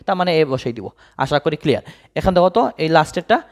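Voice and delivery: ordinary talk, nothing marked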